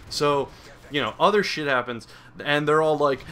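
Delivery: normal talking voice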